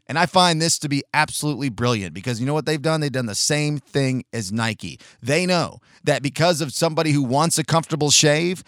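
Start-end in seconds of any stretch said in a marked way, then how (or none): none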